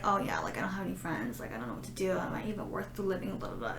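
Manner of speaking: mild imitation of a friend mumbling low.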